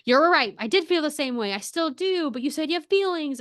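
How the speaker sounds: high-pitched, tumbling admission; imitating a flustered ramble; rambling confession. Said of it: "She starts rambling".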